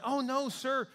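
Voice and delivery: high, pleading woman's reply. A narrator's reading. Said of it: "she replied".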